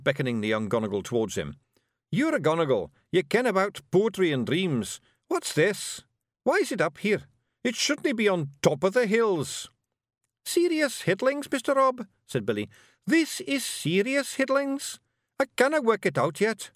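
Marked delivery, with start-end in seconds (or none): 2.1-9.7 high, insistent Scots questioning; Scottish character voice, pressing; urgent Scottish Feegle leader. Acted. Rob questions young Gonigal. 10.4-12.1 very high-pitched Scots laddie; squeaky-high character voice; young Scottish Feegle. Acted. Young Billy answers in character. 13.1-16.7 very high-pitched Scots laddie; squeaky-high character voice; young Scottish Feegle. Acted. Young Billy answers in character.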